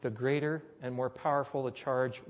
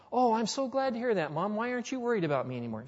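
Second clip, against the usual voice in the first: mock relief; 7 semitones higher, faster than usual. Son pleased, mother not worried.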